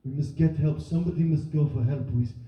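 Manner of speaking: halting English in a put-on Syrian accent; mock foreign accent. Acting a Syrian family deciding who walks to Dover, foreign accent. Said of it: "unclear why her accent is so different".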